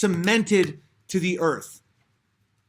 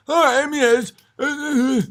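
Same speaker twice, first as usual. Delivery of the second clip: higher-pitched flustered stammer; mock stammering opponent; 6 semitones higher than usual. Streamer mocks a viewer asked to define terms, flustered stammer. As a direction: imitate someone stuck for an answer, high and halting. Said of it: "You would say".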